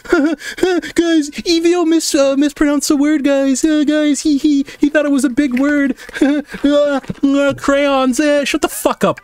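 high, whiny tattletale voice; mocking chat. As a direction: mimic chat ratting him out. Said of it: "Can we not drag this on".

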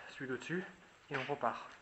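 ordinary delivery

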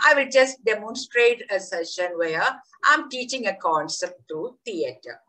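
ordinary delivery

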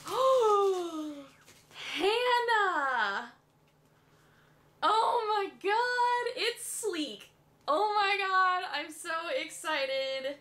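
high, drawn-out gushing; overjoyed, stretch the words out high; thrilled. Real, not acted. Thanking Hannah for the Sleek kit, thrilled. Thrilled at surprise makeup gift.

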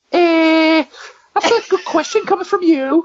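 high Scouse-tinged mock of Carragher; Carragher impression. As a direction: do a cheeky Carragher comeback. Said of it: "And his reply was".

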